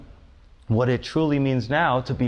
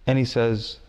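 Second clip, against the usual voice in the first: ordinary talk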